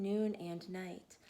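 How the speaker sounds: usual speaking voice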